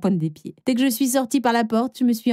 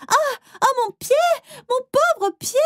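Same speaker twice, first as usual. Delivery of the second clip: high, hammed-up wail; milk the sore foot; fake pain cry. Faking a hurt foot, hopping.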